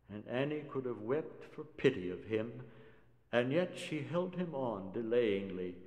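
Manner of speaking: plain reading voice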